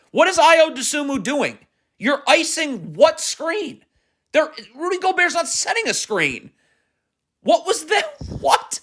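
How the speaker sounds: high-pitched, exasperated disbelief; incredulous. Real, not acted. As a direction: stretch it out in baffled frustration. Baffled, exasperated at a defensive mistake.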